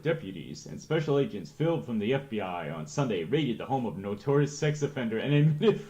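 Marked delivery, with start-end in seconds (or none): 0.0-0.7 « deputies » bien articulé, DEP-u-ties, comme une correction; deputies corrigé. Shown as a demonstration. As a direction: redire « deputies » en appuyant sur chaque syllabe. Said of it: "Deputies, not deported".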